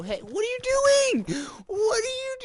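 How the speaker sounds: high, loud, disbelieving yell; alarmed shout. Real, not acted. Streamer yelling at a player who lands on him, high alarmed shout. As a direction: shriek it at the attacker. Enemy attacks with pickaxe.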